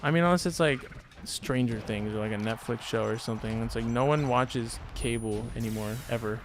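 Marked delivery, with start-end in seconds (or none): none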